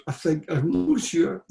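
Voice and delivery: a lightly voiced wife, unsure; wife's unsure reply. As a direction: play the doubtful wife.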